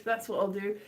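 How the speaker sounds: normal talking voice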